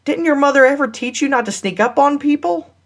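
high-pitched scolding question; indignant scolding. A narrator's reading. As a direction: scold him for startling you, without shouting. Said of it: "she yelled at him".

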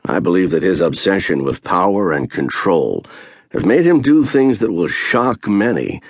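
usual speaking voice

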